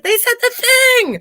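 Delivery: squealing high and loud; excited fan quote. Retold or imitated. Excited fan blurting out that they said the thing.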